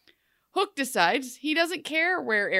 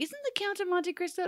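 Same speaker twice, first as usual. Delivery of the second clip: high, softer voice of a tentative thought; tentative guess; 11 semitones higher, 6 dB quieter than usual. Retold or imitated. Host replaying a search through memory, tentative. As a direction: wonder it aloud, high and soft.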